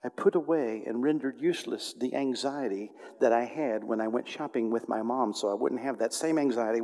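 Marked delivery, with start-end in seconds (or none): none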